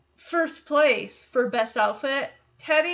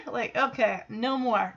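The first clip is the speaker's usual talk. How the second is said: ordinary delivery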